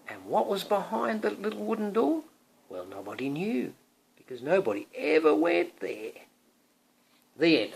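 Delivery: mysterious question, then a firm close; storybook reading. Acted. Parent reading to Thomas, mysterious storybook voice. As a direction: tease the child with the mystery.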